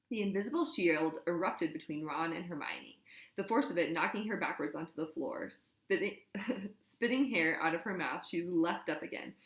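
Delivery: plain reading voice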